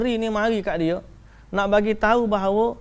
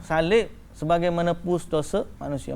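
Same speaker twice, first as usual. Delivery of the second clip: ordinary delivery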